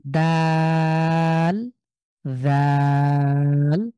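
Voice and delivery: দাল and জাল each stretched very long; slow letter drill. Shown as a demonstration. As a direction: model each letter name slowly for students. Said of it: "সবাই উচ্চারণ করেন তো".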